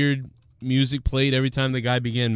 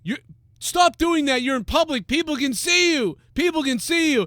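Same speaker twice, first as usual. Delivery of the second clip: high, indignant yelling at the man; outraged bystander. Pretend. Bystander telling man to stop.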